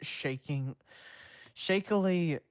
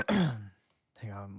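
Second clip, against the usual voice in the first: plain reading voice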